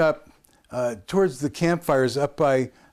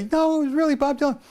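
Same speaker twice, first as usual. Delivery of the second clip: high, quick, protesting voice; insisting; faster than usual. Retold or imitated. Kid insisting to skeptical campers, high protesting voice. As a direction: squeak out the insistence, half-joking.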